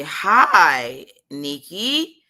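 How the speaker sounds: loud, drawn-out mocking hello; mock customer-service greeting. Rider mocking safety agent's slow reply to emergency, sarcastic greeting. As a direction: overdo the leisurely greeting in disbelief. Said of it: "you are taking the time".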